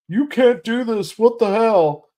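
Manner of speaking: high indignant mock protest; mocking an indignant protester; mock outraged protester. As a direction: squawk it like a dragged-out protester.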